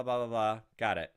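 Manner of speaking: normal talking voice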